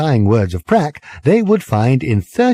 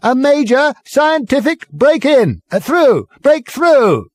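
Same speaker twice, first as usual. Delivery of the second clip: high, loud megaphone announcement; through a megaphone; 14 semitones higher, 4 dB louder, slower than usual. A narrator's reading. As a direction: blare it like a tinny loudspeaker.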